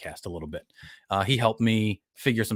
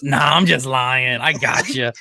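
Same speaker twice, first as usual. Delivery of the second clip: imitated boy prankster; 3 semitones higher, 6 dB louder, slower than usual. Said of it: "He goes".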